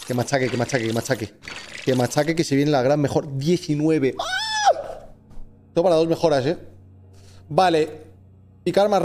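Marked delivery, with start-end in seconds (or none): 4.2-5.1 sharp high-pitched yelp. Real, not acted. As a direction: let out a sudden squeal. Sudden yelp at upgrade.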